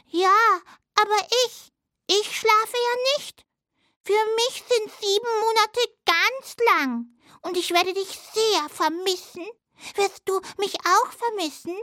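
high little mouse voice; little hazel dormouse. Acted. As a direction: play a tiny friendly mouse, pitched high. (hohe Stimme einer kleinen Haselmaus)

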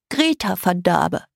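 put-on blocked-nose little girl introducing herself; congested, nasal girl's voice; stuffed-nose voice. A narrator's reading. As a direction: say it as if your nose is bunged up. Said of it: "Ihre Stimme klang, als wäre ihre Nase verstopft".

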